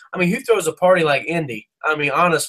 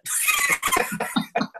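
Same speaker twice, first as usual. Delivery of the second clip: a long high burst of laughter; big laugh; 6 dB quieter than usual. Real, not acted. Laughing after telling the whiskey bottle challenge, a big laugh. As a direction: crack up at your own story. Laughs at own drinking story.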